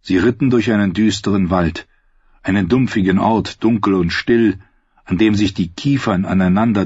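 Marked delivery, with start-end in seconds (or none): none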